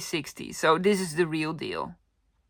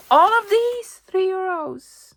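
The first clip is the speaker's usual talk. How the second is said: excited; 12 semitones higher, 5 dB louder, slower than usual. Real, not acted. Proud bargain reveal.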